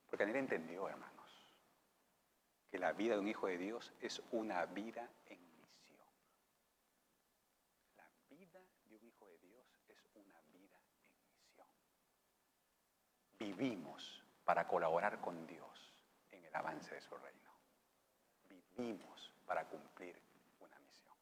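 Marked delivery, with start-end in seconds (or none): 2.7-6.1 whisper. Acted. Whispered point. 8.0-21.1 hushed whisper. Acted. Preacher whispering the calling to his congregation.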